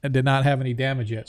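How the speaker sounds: ordinary delivery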